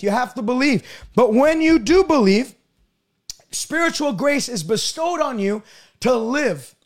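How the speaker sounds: usual speaking voice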